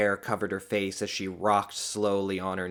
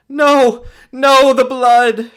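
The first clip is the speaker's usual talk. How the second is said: very high, loud, drawn-out wail; anguished cry; 16 dB louder, much slower than usual. A narrator's reading. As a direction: cry out in horror at the loss. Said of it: "she cried silently".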